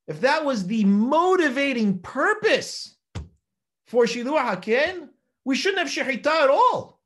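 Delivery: rising emphasis. Real, not acted. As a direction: press the argument harder with each clause.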